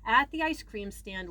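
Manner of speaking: ordinary delivery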